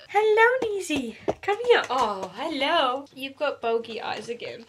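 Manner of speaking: high, cooing greeting to a pet; pet talk. Real, not acted. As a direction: coo sweetly at a little animal.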